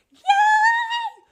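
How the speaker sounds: high, loud cheer; excited cheer. Real, not acted. Cheering family assignment.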